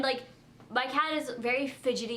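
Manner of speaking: ordinary delivery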